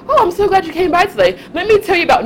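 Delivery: mock a gushing door-knocking welcome; imagined cheery householder. Mocking householders who welcome you in their PJs, gushing and high. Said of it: "be like".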